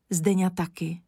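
ordinary delivery, nothing marked

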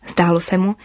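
ordinary delivery

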